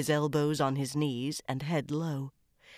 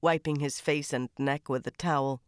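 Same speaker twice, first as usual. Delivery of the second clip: plain reading voice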